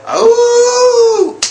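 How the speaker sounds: high, loud wolf howl with a cry; howl at the moon. Retold or imitated. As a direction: howl at the full moon with all you've got. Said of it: "and we go".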